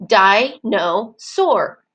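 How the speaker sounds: "dinosaur" in three separate beats, a pause after each; slow, evenly split "dinosaur" for small children; broken into syllables. Shown as a demonstration. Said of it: "Did you hear how I broke it up?".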